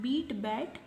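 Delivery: बीट with a long ee, then बैट with a short open a; beat then bat. Shown as a demonstration. Teacher shows students बीट and बैट pronounced differently. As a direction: contrast बीट and बैट, same spelling. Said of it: "pronunciation है वो change हो जाएगा".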